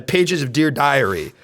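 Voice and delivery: normal talking voice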